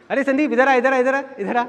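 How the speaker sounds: high-pitched repeated summons; aunt calling out. Retold or imitated. Aunt calls Sandeep over at a family party, calling out. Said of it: "मेरी मामी जी आवाज़ लगाती थी".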